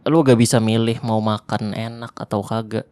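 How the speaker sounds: ordinary talk, nothing marked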